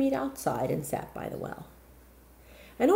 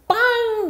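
clock chime; 8 dB louder than usual. Acted. Clock chiming.

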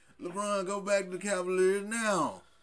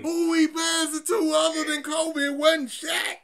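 raised voice, yelling his point; shouting; 12 semitones higher, 7 dB louder than usual. Real, not acted. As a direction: shout it across the table. Heated argument about Kobe.

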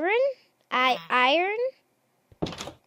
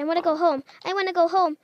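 ordinary delivery

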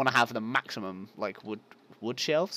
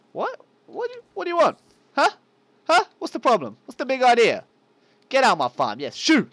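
loud, high-pitched play challenge; mock confrontation; 13 semitones higher, 9 dB louder than usual. Player challenging a spider on his farm, loud mock outrage. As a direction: pick a mock fight, shooing it off.

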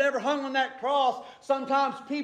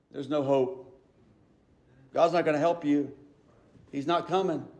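low, measured pronouncement of no hope; grave crowd verdict, pitched well down; hopeless verdict; 8 semitones lower, 3 dB quieter than usual. Acted. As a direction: deliver it low, in short separate sentences.

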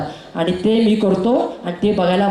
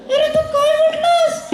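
sing-song puppet greeting. Acted. The puppet greets Kolhapur in a much higher sung voice. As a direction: croon hello to the crowd as the puppet.

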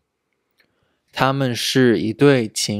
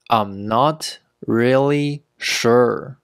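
slow and separated, word by word; slow teaching pace. Shown as a demonstration. Teacher modelling "not really sure" for learners, slowly. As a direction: take it slowly so learners can copy.

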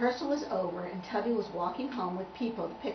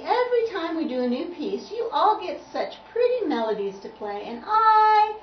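raised, loud lament; plaintive complaint; 8 semitones higher, 8 dB louder than usual. Acted. Tubby complaining about pretty melodies, plaintive and rising. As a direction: build to a high, wailing "I".

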